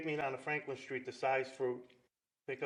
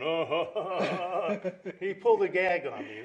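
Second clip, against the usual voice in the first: laughing it out, louder; amused laughter while telling; 4 semitones higher, 8 dB louder, much slower than usual. Real, not acted. Laughing at the prank story.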